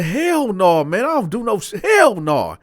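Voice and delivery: loud, high, indignant refusal; emphatic denial. Retold or imitated. Teller refusing Bo's suggestion, emphatic denial. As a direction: reject it outright, loud and high.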